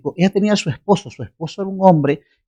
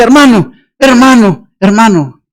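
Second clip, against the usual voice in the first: louder, higher urgent calls; calling out; 4 semitones higher, 6 dB louder than usual. Retold or imitated. Husband calling the preacher loudly across the street. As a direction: call out to stop him.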